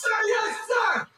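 high shouted sir yes sir. Pretend. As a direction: bark it like an eager recruit. Mock military reply to friend.

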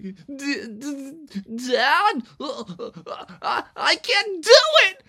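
stammer, then burst out high and loud; straining, stammering frog. Acted. Froggy fails to sit still beside dad, strained outburst. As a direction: fight to hold still until it explodes.

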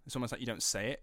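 ordinary talk, nothing marked

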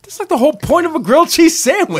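high, loud exasperated complaint; indignant. Retold or imitated. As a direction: protest it, fed up and high. Indignant quote about mayo.

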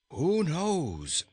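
a touch of a shudder in the voice. A narrator's reading. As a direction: ask it with mild dread. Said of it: "answered Rinkitink with a shudder".

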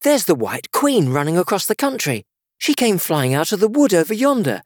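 high-pitched excited report; eager. A narrator's reading. Alice showing the King the running Queen, eagerly. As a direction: point out a sudden sight eagerly. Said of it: "pointing eagerly".

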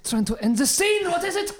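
high-pitched mock exasperation; exasperated. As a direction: snap at the interruption, pitch shooting up. Exasperated at an interruption.

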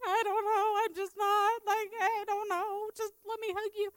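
high-pitched mock-crying wail; imitated crying. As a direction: wail it out as if bawling. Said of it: "holding her and crying".

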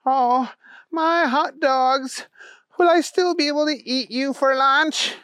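put-on a childlike hero mourning his spilled treasure; Booger's wail. Booger's voice in story.

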